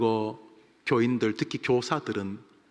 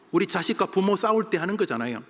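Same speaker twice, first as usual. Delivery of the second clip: ordinary talk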